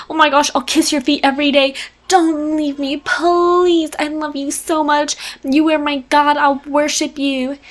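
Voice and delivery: high-pitched, hammed-up begging; imitating grovelling devotion in a high voice; mock pleading. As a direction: plead like a desperate adoring husband, overdone. Acted begging husband.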